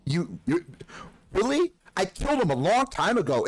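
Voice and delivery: high, smug fake astonishment; mock surprise. Gloating at friends after the game, mock surprised. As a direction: play dumb then gloat.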